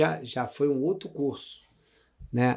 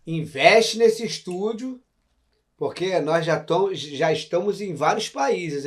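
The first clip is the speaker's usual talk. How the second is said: ad read; 2 semitones higher, 4 dB louder, slower than usual. Acted. Sponsor shout-out read.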